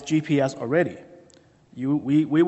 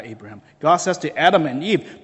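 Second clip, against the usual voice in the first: usual speaking voice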